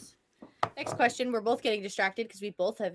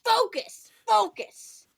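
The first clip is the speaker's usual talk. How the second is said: loud mock urging; 11 semitones higher, 8 dB louder, much slower than usual. Mock urging oneself to focus.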